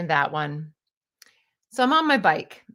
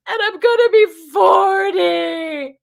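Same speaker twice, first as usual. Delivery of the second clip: much higher, loud, slow wail; mock wailing; 10 semitones higher, 10 dB louder than usual. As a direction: sob it out, hammed up. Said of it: "he goes".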